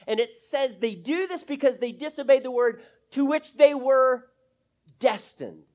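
loud, high, driving sermon voice; preaching at full force. Acted. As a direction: preach it out with growing fire.

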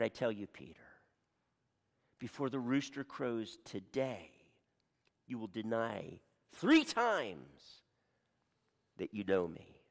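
quiet prophecy; low grave reply. Acted. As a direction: drop low and soft, heavy with sorrow. Jesus answering Peter gravely.

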